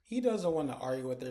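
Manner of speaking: ordinary delivery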